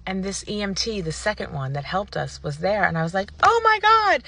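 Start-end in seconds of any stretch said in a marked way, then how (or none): 3.4-4.2 high, loud delighted exclamation; gushing, high-pitched surprise; excited greeting. Retold or imitated. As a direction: squeal it on spotting someone you know. Retold surprise meeting at Starbucks.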